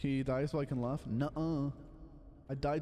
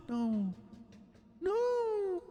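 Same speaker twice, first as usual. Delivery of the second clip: a real dismayed squeal; shocked high cry. Real, not acted. Player seeing a teammate die, cries out high. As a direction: gasp then shriek no, much higher.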